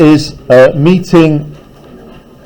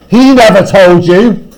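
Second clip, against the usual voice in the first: a touch of anger, slow; flush of anger; 4 semitones higher, 4 dB louder than usual. Acted. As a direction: cry it out, mildly angry. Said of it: "cried Mister Hyde, with a flush of anger".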